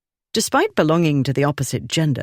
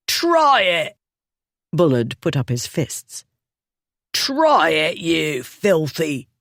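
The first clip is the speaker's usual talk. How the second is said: put-on defiant drunk. A narrator's reading. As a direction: dare him to swing. Bullard defiant.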